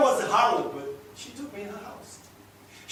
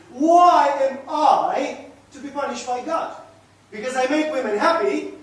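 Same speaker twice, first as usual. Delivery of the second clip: loud, raised voice arguing his case; indignant protest; 5 semitones higher, 7 dB louder than usual. Acted. Man defending his treatment of women to his companion, indignant. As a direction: lean hard on each point of your defence.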